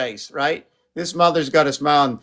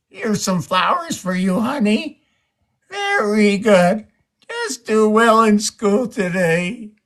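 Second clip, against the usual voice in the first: higher, slowing down on the last words, a doting dad; fond father voice; 7 semitones higher, slower than usual. Acted. Father figure handing flowers to his girl, doting. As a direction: hand over the flowers like a proud father.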